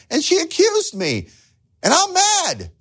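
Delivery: imitating a husband's high, hurt complaint; hammed-up wounded protest; mock angry husband. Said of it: "He says to me".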